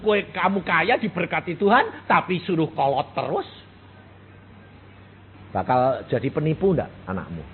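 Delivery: ordinary delivery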